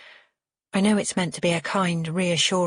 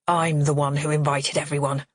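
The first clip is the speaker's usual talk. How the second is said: usual speaking voice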